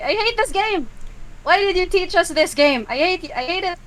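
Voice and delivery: high, loud whiny complaint; frustrated whine. Acted. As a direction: whine that you hate the game. Panicked players complaining.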